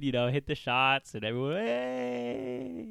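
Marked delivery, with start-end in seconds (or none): 1.6-2.9 high, held-back cheer; imitated crowd cheer. Everyone hyped when he hits shots, imitated cheer. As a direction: cheer up high without real volume.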